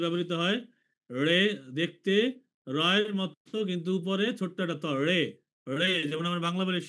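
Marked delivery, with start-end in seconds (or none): none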